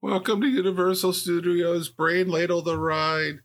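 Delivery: theme park ride announcer voice; cheesy amusement park PA voice. Pretend.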